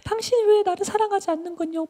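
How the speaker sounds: very high, whiny reproach; acted nagging in a put-on high voice; pestering complaint. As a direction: whine like a neglected lover. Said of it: "막 따라다니면서 막 졸랐어요".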